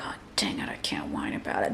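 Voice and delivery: mock whisper. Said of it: "and then they're like".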